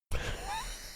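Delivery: soft, very high giggle. Real, not acted. Laughs at the drawing.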